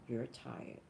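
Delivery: soft, tired-sounding sister telling her to go home; soft sister's voice. Retold or imitated. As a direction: quietly repeat the sister's gentle order. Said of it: "And she goes".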